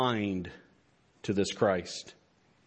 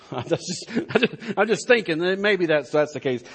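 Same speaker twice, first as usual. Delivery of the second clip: laughing; 5 semitones higher than usual. Real, not acted. Laughing through a joke.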